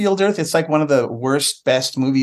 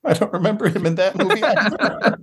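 real laughter breaking into the words, higher pitch; laughing; 5 semitones higher than usual. Real, not acted. Co-host admits forgetting yet another movie, laughing. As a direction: laugh through the admission.

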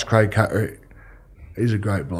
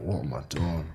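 inner thought; 7 semitones lower, 11 dB quieter than usual. Retold or imitated. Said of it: "I just thought".

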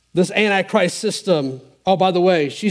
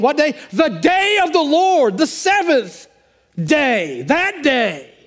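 higher, swelling preacher's cadence; preaching; 8 semitones higher than usual. Acted. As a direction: build the sermon to a fervent peak. Preaching builds up.

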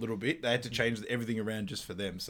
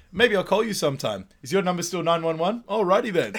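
higher, louder cheeky sign-off; smug goofy farewell; Ace Ventura voice; 6 semitones higher, 6 dB louder than usual. Retold or imitated.

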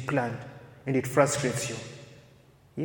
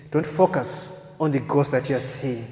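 usual speaking voice

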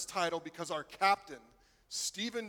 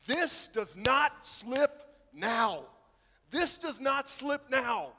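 slow, raised, deliberate rallying cry with "not" leaned on; rallying captain; 5 semitones higher, 5 dB louder, slower than usual. Retold or imitated. Captain Gerrard to his team in the huddle, slow determined rallying cry. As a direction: impersonate the captain stirring his team, each word planted. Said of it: "gathers the team into a huddle and says this".

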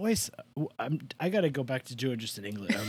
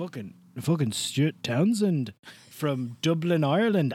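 put-on Stuart Townsend, Irish accent. Guest answering where the actor is from, Irish accent. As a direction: lay on a Dublin Irish brogue, unhurried. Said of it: "Is he Dublin?".

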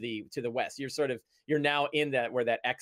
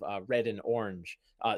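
ordinary talk, nothing marked

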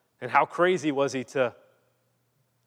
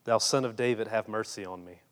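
hushed plea; 5 semitones lower, 6 dB quieter than usual. Retold or imitated. Blind man begging Jesus.

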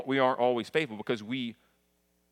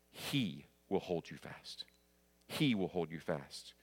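low, soft, unhurried promise; hushed pastoral comfort; tender assurance; 5 semitones lower, 7 dB quieter, slower than usual. Real, not acted. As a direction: reassure them quietly, dropping the pitch. Applies hymn refrain to listeners.

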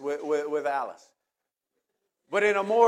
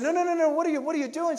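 high, loud panicked no no no; panicked protest; 12 semitones higher, 7 dB louder than usual. Retold or imitated. To Alice closing the elevator door, a high panicked protest. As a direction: cry out as the doors start closing.

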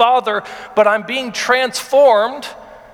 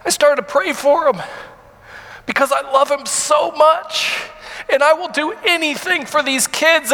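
higher, strained voice through tears; pressing on while crying; real crying, voice breaking; tearful; 5 semitones higher than usual. Real, not acted. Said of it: "I'm sorry".

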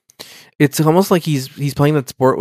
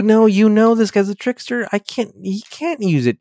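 a higher, exasperated scolding voice; lightly voiced Data; 10 semitones higher than usual. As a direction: scold him like a know-it-all android. Data scolding Riker, acted quote.

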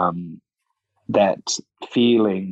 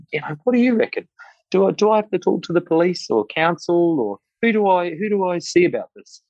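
pitched up, naive and unsure, questions tumbling out; asking Colin for advice; 9 semitones higher than usual. Retold or imitated. Retelling how he asked the old cyclist, higher and unsure. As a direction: play your younger self asking an old mentor how it's done. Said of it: "And I said".

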